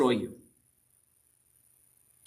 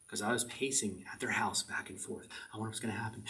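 whispered aside; 5 semitones lower, 12 dB quieter than usual. Real, not acted. Unvoiced whisper in retelling.

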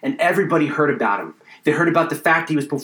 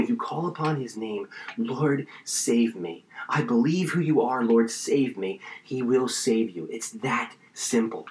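hushed appeal; 4 semitones lower, 7 dB quieter than usual. Real, not acted. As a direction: gently draw them toward a simple prayer. Gently urging listeners to pray to be saved.

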